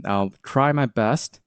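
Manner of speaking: usual speaking voice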